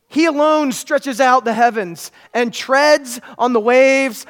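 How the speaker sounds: loud, rising sermon declaration, pressing each question home; full-force preaching. Acted. Pastor to congregation on Jesus treading the waves, booming preaching. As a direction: proclaim it like a revival preacher at the climax.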